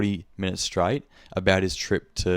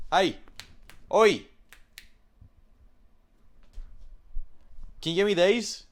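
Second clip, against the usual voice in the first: high calling shout, much higher; 12 semitones higher than usual. Retold or imitated. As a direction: yell across the shop for attention. Customer yelling for attention.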